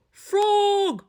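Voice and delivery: high, loud call into the dark; worried call. A narrator's reading. Toad calls out for vanished Frog, high and loud. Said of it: "said Toad".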